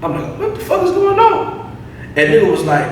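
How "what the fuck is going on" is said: high, drawn-out mock disbelief; retold alarm. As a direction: act out being thrown, pitched way up. Retold alarm at stranger approaching.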